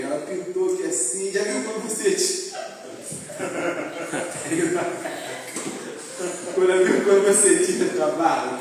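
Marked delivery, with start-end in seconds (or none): 2.6-6.5 long real laugh. Real, not acted. Laughing at his painted beard.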